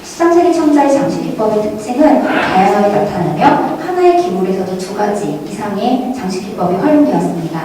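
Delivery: ordinary delivery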